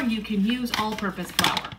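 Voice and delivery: ordinary delivery, nothing marked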